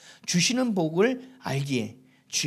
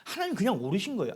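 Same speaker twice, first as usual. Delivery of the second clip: usual speaking voice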